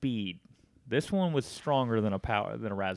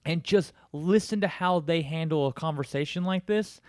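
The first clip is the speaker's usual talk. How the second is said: higher, louder condescending tips; sarcastic advice; 6 semitones higher, 4 dB louder than usual. Retold or imitated. Mocking the scammer with advice, sarcastic condescension. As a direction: lecture him like a failing pupil. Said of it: "I was like".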